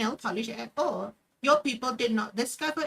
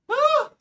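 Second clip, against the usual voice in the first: a sudden high, loud frustrated "woo" yell; frustrated whoop; 9 dB louder than usual. Real, not acted. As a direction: let the frustration burst out as a shout. Frustrated outburst again.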